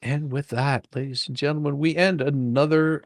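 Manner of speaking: normal talking voice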